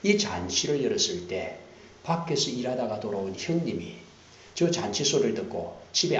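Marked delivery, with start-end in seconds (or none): none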